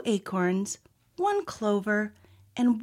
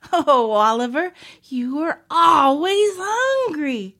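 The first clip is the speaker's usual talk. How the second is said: loud, drawn-out mock exasperation; chuckling amusement; 5 semitones higher, 8 dB louder, slower than usual. A narrator's reading. Olivia to Oliver wanting a snack, amused teasing. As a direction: stretch "always hungry", climbing high and loud. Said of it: "Olivia chuckled".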